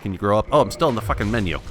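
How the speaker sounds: ordinary delivery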